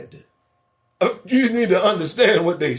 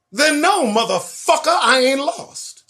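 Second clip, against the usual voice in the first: high-pitched, slow indignant retort; defiant comeback; 9 semitones higher than usual. Acted. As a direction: draw out an indignant answer to a nosy question. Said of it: "that's how you answer".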